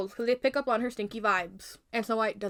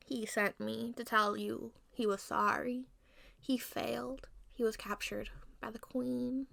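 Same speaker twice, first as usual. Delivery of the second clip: put-on slow, soft, solemn old lady; hushed, measured old-woman voice; 7 dB quieter, slower than usual. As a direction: break bad news slowly and quietly. Disguised queen breaks bad news.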